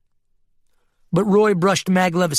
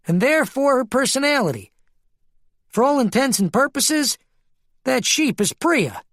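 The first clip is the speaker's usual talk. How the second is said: much higher, insistent character voice; high-voiced Keen explaining; 10 semitones higher than usual. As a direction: make the case in a high, emphatic voice.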